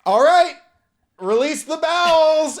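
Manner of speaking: high, loud, slow announcement; booming command. Acted. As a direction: proclaim it like a grand order. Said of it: "And my body goes".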